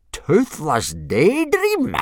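slow, high, loud disbelief; drawn-out incredulous bellow; blustering. A narrator's reading. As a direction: bluster it back, outraged. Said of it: "blustered Gobber".

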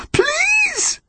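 very high, anguished plea; desperate cry. A narrator's reading. Connor pleading as his grip fails, anguished cry. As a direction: beg it in a wailing cry.

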